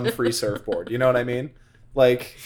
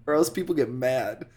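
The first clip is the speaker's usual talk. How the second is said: usual speaking voice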